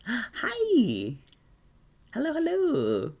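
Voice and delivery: high-pitched excited hello; gasp, then greet them way up high; delighted greeting. Real, not acted. Greeting arrivals on stream.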